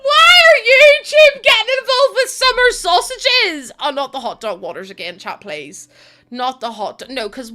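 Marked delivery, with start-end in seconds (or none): 0.0-3.7 shout. Real, not acted. Streamer shrieks at chat.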